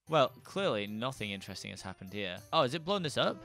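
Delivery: normal talking voice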